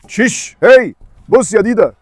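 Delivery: loud, drawn-out warning; slow loud shout. Real, not acted. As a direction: call the dog to order. Shouting at unruly dog. (زعقة بطيئة)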